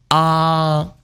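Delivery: AH held high and level; high first tone. Shown as a demonstration. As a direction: hold "ah" on one high, even note. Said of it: "First tone high".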